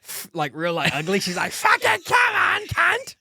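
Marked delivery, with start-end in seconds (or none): 1.5-3.2 slow, high, loud angry shout; mock ratty yell. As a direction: snarl it at the screen. Friend yelling at TV ratty.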